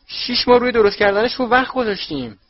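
high, very loud protest; outraged shout. A narrator's reading. Son protesting as mother bins his toffees, high loud yell. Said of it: "فرد فریاد زد".

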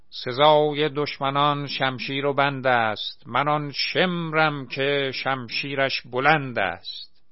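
loud, slow war-cry verse; roaring boast. A narrator's reading. Angry Asghar roaring his battle verse at the laughing crowd, loud slow boast. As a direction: roar the battle verse like a stage villain. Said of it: "نعره زد".

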